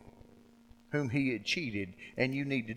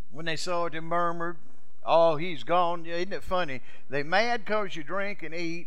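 higher, louder preacher's proclamation; fiery preaching; 6 semitones higher, 7 dB louder than usual. Acted. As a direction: preach it out to the congregation. Preaching at full force.

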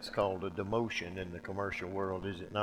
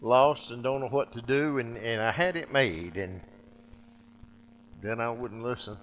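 louder, higher lament of a fallen king; voiced lament; 4 semitones higher, 9 dB louder than usual. David mourning his lost palace, loud lament. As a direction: cry out what was lost.

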